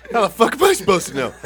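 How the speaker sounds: loud, high mock outrage; hammed-up mom snapping back; mom's indignant retort. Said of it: "She goes".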